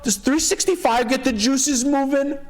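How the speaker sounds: high sing-song pitch of a lowball offer; bad offer delivery. Shown as a demonstration. Agent showing how to deliver a bad offer, high and airy. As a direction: float it up high, stretched out. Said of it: "how you deliver that bad offer".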